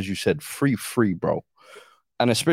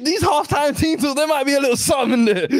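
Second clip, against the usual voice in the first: excited; 13 semitones higher, 3 dB louder than usual. Retold or imitated. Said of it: "I was like".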